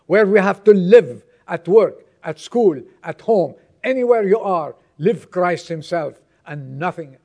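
rally the congregation with punched phrases; impassioned preaching. Acted. Preacher telling the church to stand up and preach, impassioned.

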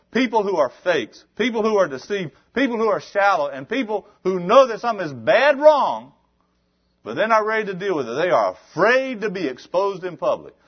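high, loud preacher's cry; full-force preaching. Real, not acted. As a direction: hammer it home to the congregation.

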